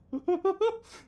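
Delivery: sudden high burst of laughter; amused high laugh; real laugh. Real, not acted. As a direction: laugh at your own botched accent. Laughs at his own accent mix-up.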